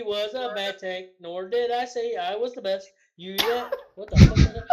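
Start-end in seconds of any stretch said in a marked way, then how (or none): 3.9-4.7 half-whispered, loud laughing disbelief; real laughing what-the-hell; laughing outburst. Real, not acted. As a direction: blurt it out through a laugh.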